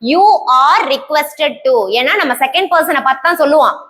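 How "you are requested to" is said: each word drawn out very slowly for the class; slow dictation. Shown as a demonstration. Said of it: "அப்படின்னா". (மிக மெதுவாக dictation சொல்வது)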